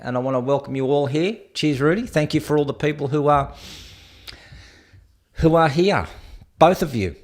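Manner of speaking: ordinary talk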